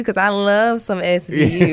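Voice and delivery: slow, loud, laughing admission. Real, not acted. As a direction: confess it through a laugh. Laughs while naming favourite show.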